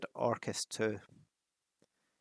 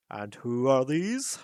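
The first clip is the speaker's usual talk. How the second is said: slow, louder captain with a put-on accent; guard captain voice; 6 dB louder, slower than usual. A narrator's reading. Captain of the guard questioning Thorin, odd accent. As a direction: question them suspiciously. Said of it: "he asked".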